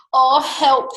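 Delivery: high, loud cry for help; alarmed fox. Acted. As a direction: yelp it in storybook fright. Fox frightened by Gruffalo.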